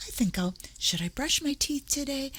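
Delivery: high, soft, airy pondering; mock deliberation. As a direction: play a ditherer wondering aloud over the obvious. Mimics someone pondering obvious habits.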